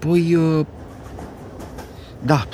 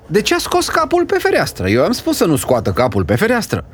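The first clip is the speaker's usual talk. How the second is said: spiteful; 8 semitones higher, faster than usual. Acted. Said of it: "zice cu pică urâtul".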